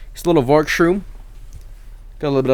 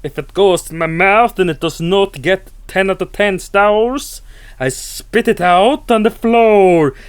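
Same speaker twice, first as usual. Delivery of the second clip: slow, louder, higher pompous snob voice; mock beer snob; 6 semitones higher, 4 dB louder, slower than usual. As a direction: puff up like a snooty connoisseur. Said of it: "I'm never like".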